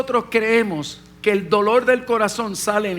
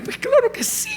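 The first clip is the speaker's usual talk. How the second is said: overjoyed yes. Retold or imitated. Son eagerly accepting father's invitation.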